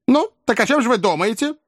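put-on high-pitched questioning old lady. A narrator's reading. Old lady quizzing her coachman, high questioning voice.

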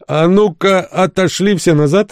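high-pitched order to stand clear; commanding call. Acted. Narrator ordering everyone back from the door, commanding.

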